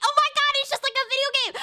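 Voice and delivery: high, rushed gushing; mock a clueless American's fast, high-pitched excitement; mocking naive American.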